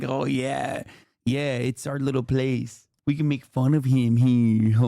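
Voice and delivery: slow, low mock voice of the chat; put-on dopey gloating, drawn out; mocking imitation. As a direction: imitate smug fans, dragging each word. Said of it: "You're like".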